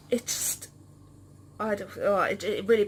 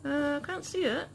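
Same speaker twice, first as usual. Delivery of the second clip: ventriloquist voice; 4 semitones higher than usual. Pretend. Ventriloquist bit with pet.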